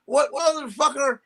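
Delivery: high, odd-sounding cursing; Cosby cursing. Retold or imitated. Comic mimics his old boss cursing off camera, high and odd. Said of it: "He's like".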